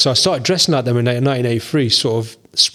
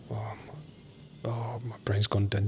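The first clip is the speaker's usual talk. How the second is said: very soft, slow whisper under the breath; whispered self-talk; 3 semitones lower, 15 dB quieter, much slower than usual. Real, not acted. Speaker trying to recall a film title, whispering to himself. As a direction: whisper to yourself while searching your memory. Muttering to himself, searching memory.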